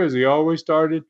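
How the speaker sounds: ordinary delivery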